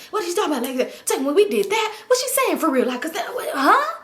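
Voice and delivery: high mock fussing that runs into gibberish; mumbling, fussing homeboy. Homeboy fussing about what she said, high garbled mumbling. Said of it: "his homeboy still just mumbling, fussing".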